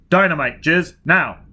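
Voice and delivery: loud command over the radio; calling out. A narrator's reading. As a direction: call the order out sharply. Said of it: "Foyle called".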